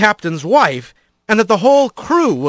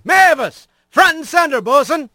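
captain's shout; 9 semitones higher than usual. Acted. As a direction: call her up like a ship's captain. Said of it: "the captain shouted".

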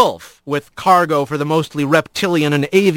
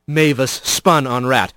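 usual speaking voice

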